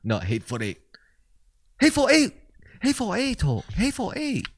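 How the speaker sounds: high-pitched mock Chinese accent. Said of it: "I was trying to do a Chinese accent".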